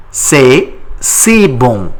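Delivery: C'est si bon, slow with stress on si; slow model. Shown as a demonstration. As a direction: spell it out slowly. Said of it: "How do you say it in French?".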